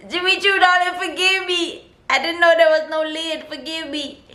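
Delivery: high-pitched playful plea; mock pleading apology. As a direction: beg forgiveness in a high, hammed-up voice.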